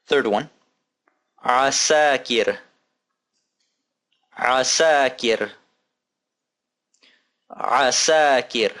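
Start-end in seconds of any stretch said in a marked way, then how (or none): 1.4-8.7 "Asakir" said slowly and clearly, syllable by syllable; slow model word. Shown as a demonstration. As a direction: spell out "Asakir" slowly for learners. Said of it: "model word repeated slowly".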